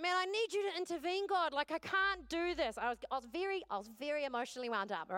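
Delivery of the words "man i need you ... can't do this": high, loud, pleading for rescue; beg, close to tears; desperate plea. Retold or imitated. Overwhelmed mother begging God to intervene, high and loud. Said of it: "And I just was like".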